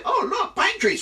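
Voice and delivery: high, loud, gleeful taunt; mock delight. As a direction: feign cheerful discovery to torment someone. Eagle gleefully picks next torment.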